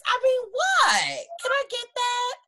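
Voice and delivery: high, loud, overjoyed yelling; can barely get the words out for joy; excited shout. Real, not acted. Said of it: "I'm stuttering".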